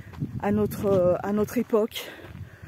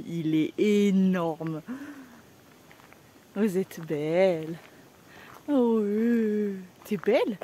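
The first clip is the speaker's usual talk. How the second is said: slow awed coo; much slower than usual. Real, not acted. Admiring the animals.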